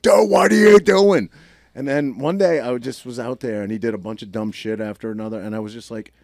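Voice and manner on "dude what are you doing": high, loud, drawn-out exasperated dad yell; retold yell. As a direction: yell in disbelief at your reckless kid. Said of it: "I used to yell and be like".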